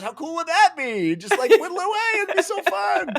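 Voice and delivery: squealing high through laughter; mock gushing enthusiasm. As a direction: gush giddily, way up in pitch. Acted gushing pitch, laughing.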